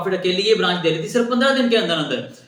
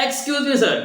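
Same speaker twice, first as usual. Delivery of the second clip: higher, sing-song call; calling after him; 8 semitones higher, much slower than usual. Retold or imitated. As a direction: pitch it up to catch his attention. Salesman calls customer back.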